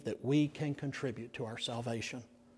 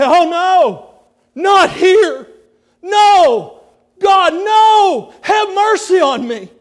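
higher, louder anguished shout; preacher voicing Sproul's plea from hell; desperate pleading; 14 semitones higher, 16 dB louder, slower than usual. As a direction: cry out in terror to God. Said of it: "If I woke up in the morning in hell".

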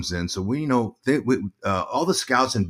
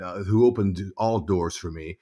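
ordinary talk

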